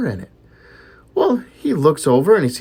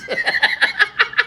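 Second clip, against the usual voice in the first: laughing. Real, not acted. Laughs at own punchline.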